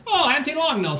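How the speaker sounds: high, loud sing-song greeting. Pretend. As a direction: call it out like a cheery greeting. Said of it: "Is that like a greeting?".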